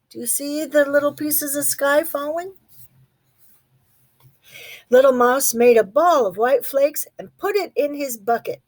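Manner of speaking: usual speaking voice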